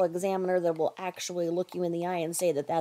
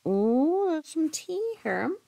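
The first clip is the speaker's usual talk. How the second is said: high, slow "ooh, tea"; excited gossip; 9 semitones higher, slower than usual. Real, not acted. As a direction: savor the juicy gossip.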